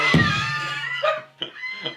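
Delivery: burst of laughter. Real, not acted. Laughing at friend.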